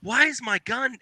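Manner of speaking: high, puzzled mock question; high bewildered squeak; mock confusion. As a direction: act baffled at your jammed gun. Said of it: "and it's like".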